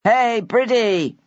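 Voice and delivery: slow, drawn-out friendly call; a cheerful man hailing Bridget from the house. A narrator's reading. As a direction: call across the drive to her. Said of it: "called one of them".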